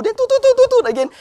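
high, loud do-do-do bleep; mock censor beep. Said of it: "bleeping out words".

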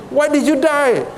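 high-pitched comic wail of reproach; mock lament. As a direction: complain to the dead man, hammed up. Joke's punchline lament to dead man.

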